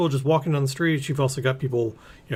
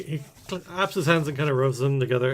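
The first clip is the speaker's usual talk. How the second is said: normal talking voice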